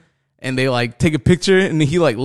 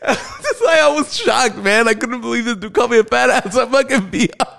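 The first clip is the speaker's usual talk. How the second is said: much higher, squeezed out through real laughter; helpless laughter; 10 semitones higher than usual. Real, not acted. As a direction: struggle to talk while cracking up. Cracking up after own punchline.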